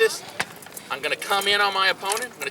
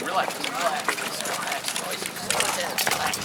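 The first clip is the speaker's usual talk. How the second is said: soothing; 2 semitones lower, 5 dB quieter, slower than usual. Shown as a demonstration. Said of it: "Nice and easy".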